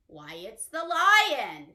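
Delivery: big slow storytime reveal; grand reveal. Acted. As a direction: announce the lion with a flourish. Big reveal of riddle answer.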